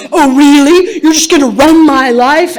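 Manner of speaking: loud, indignant comeback; indignant retort. Retold or imitated. Dad snapping back at his daughter, loud and indignant. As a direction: bristle back, offended.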